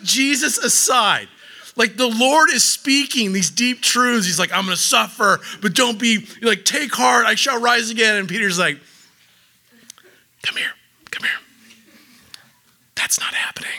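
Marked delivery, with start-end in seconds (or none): 4.5-8.2 proclaiming voice. Retold or imitated. Jesus voiced, rising proclamation. 10.4-13.7 secretive whisper, pulling him aside; conspiratorial whisper. Retold or imitated. Peter pulls Jesus aside.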